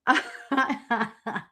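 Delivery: helpless run of laughter; overcome laughing. Real, not acted. Reader overwhelmed by Betty's card, dying with laughter. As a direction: laugh as if it's all too much. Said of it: "I'm, like, dying here".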